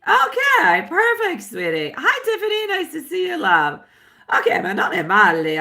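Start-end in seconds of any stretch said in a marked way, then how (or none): 0.1-1.4 much higher bright exclamation; excited. Real, not acted. Greeting next sitter brightly. 2.0-3.4 much higher cheerful hello; excited greeting. Real, not acted. Greeting next sitter brightly.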